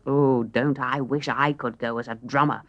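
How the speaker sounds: usual speaking voice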